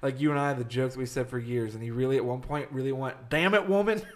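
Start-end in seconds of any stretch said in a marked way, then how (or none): 3.3-4.0 high, exasperated hillbilly outburst; hillbilly husband. Retold or imitated. Imitating the customer arguing with his wife on the phone, hillbilly exasperation. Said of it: "like our hillbilly accents".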